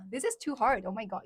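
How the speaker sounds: usual speaking voice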